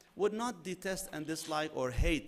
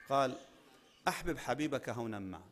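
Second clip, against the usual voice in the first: hadith recitation; 2 semitones lower, much faster than usual. Acted.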